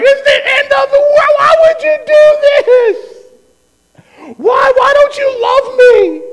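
very high, loud mock-crying wail; hammed-up wailing. Dad over daughter's scratches on the car, hammed-up despair. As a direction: wail it slowly like a melodramatic tantrum.

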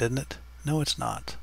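normal talking voice

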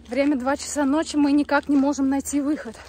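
mock panic. Mock horror chase narration.

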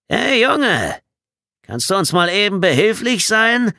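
high, loud holler; calling out. A narrator's reading. Bedford pointing at the ball by the wire, calling loudly. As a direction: shout a request across the field. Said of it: "rief Bedford".

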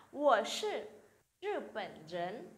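slow, careful Mandarin answer; slow model reading. Shown as a demonstration. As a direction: spell it out slowly for learners. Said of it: "tiếp theo nào".

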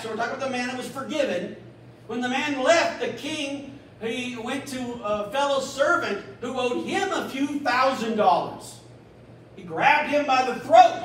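usual speaking voice

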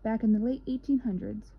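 ordinary delivery

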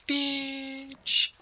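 very high, drawn-out squeak; hammed-up furtive falsetto; furtive sketch punchline. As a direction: stretch it thin and high for comedy. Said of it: "quietly they look at each other".

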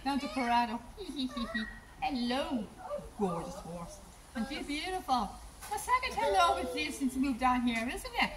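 high, soft pet-talk voice; soft high sing-song pet talk; cooing to a horse. Real, not acted.

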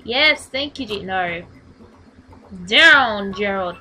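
loud barked orders; shouted commands. Real, not acted. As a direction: yell directions at him.

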